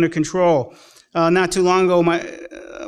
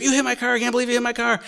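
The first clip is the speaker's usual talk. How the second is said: high-pitched mock complaint, not shouted; mimicked angry accusation; 7 semitones higher, much faster than usual. Preacher replays the stranger's accusation, high mock indignation. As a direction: imitate an outraged driver in a high voice.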